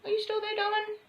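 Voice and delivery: high, soft coo checking on a pet; sweet pet talk. Real, not acted. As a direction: call sweetly to a shy little bird. Calling softly to her bird.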